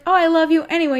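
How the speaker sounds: loving friends gushing loudly; gushing others. Retold or imitated. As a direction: coo it like an adoring friend. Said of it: "people are like".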